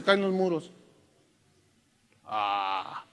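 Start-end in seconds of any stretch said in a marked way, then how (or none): 2.2-3.0 low, soft feeble ah; a deflated, half-hearted grunt; limp little ah. Pretend. Mocking a feeble shout at the walls, low and limp. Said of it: "¿Así le dijo?".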